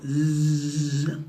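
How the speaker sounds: imitated thin insect buzz; mosquito buzz.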